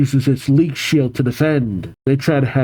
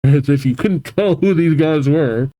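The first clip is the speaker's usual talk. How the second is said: ordinary delivery, nothing marked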